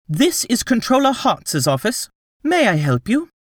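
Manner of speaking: put-on receptionist girl voice. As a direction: chirp it like a desk receptionist.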